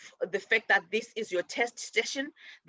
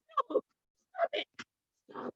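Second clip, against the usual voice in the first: much higher, shrill stop; sharp yelp at the dog; 7 dB quieter than usual. Real, not acted. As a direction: snap at the dog to quit. Shouting at the dog.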